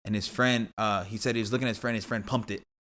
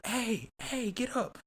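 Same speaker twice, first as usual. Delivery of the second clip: much higher, thin distant call; retold calling out; 13 semitones higher, 4 dB quieter, much slower than usual. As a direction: imitate someone calling to rouse the fallen. Acts voice calling to fallen man.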